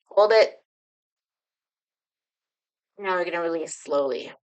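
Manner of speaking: guided meditation. Acted. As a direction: ease them gently into the feeling. Leads a guided breathing exercise.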